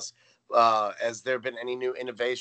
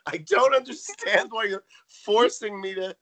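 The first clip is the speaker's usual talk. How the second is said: very high, hammed-up confused protest; mock bewilderment; 10 semitones higher, 4 dB louder than usual. Missus Garrett confused by forced help, high mock-bewildered protest. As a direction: squeal it in exaggerated bafflement.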